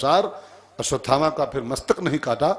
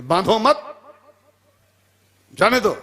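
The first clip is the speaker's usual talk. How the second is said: "बांधो मत" together rising high, pause, then "जाने दो"; higher, louder "बांधो मत?... जाने दो"; misread order; 6 semitones higher, 5 dB louder than usual. Shown as a demonstration. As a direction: break after "मत", releasing "जाने दो". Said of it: "ऑर्डर आया".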